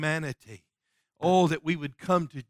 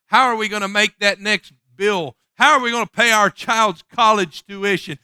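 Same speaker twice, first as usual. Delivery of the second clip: louder, rising preacher's cadence; preaching climax building; building sermon fervor; 5 semitones higher, 9 dB louder than usual. Acted. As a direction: stack the worries up as you preach.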